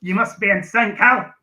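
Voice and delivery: put-on Scottish accent, punchy finish; mauled Scottish accent. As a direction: maul it in Scottish, rising in pitch. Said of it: "that was me mauling the Scottish accent".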